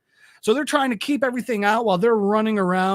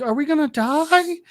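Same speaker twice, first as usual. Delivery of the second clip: higher, small frightened question; a scared kid asking the grown-up; little girl's fear; 8 semitones higher than usual. Retold or imitated. Little girl asking the principal, high and scared.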